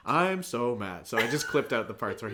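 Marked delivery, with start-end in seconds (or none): 0.1-1.1 loud, slow mock line reading; announcing. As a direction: proclaim it stiffly, imitating a bad actor. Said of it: "he was, like, announcing it".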